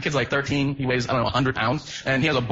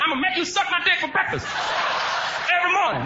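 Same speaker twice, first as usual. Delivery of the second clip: high shouted threat, ending in a yell; high mock yell; 11 semitones higher than usual. Prisoner yelling at middle schoolers, high mock shout. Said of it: "He's like".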